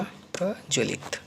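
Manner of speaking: प्रज्वलित drawn out syllable by syllable; teacher spelling out प्रज्वलित slowly; slow dictation. Shown as a demonstration. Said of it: "अगला शब्द है प्रज्वलित".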